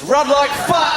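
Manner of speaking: slow, loud shout of alarm; shouted punchline. Acted. Comic bellowing run like fuck to the audience, slow shout. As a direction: bellow the warning slowly.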